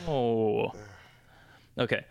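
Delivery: ordinary talk, nothing marked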